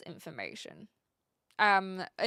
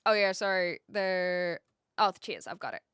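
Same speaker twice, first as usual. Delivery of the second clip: ordinary talk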